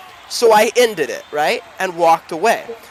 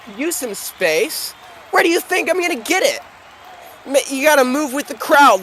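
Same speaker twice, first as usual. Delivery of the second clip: angry crowd woman; 8 semitones higher than usual. Acted. Woman in the shoving crowd yelling at the player, angry.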